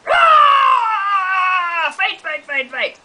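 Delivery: cartoon battle cry; mock fight cry. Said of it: "they can go".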